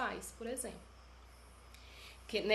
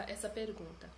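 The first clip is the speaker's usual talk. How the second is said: normal talking voice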